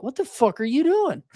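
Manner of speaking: very high-pitched incredulous challenge. Retold or imitated. Man challenging his woman, incredulous.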